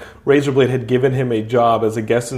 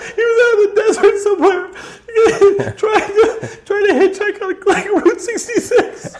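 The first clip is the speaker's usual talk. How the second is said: words squeezed out through high, helpless laughter; can barely speak for laughing; laughing hard. Real, not acted. Still laughing telling the plot.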